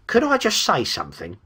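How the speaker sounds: high, wheedling creature voice; obsequious but smug. A narrator's reading. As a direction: ask permission with oily, smug deference. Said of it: "obsequious but unmistakably smug".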